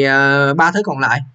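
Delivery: ordinary talk, nothing marked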